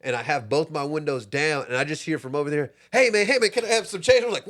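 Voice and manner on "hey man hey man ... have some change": louder, higher call for change. Retold or imitated. Man at a car window asking for change, mock call. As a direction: shout from the roadside.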